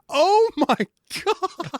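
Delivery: very high, hammed-up disbelieving gasp; shocked reaction. Recalling his reaction to the dark joke, in a high shocked squeal. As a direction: squeal it like you can't believe what you just saw. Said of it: "And I was like".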